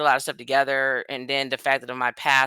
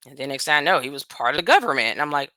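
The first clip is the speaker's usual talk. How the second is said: ordinary delivery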